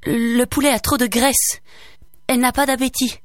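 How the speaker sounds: lightly voiced anxious mother; wife covering. A narrator's reading. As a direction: protect the child quickly. Wife excuses daughter.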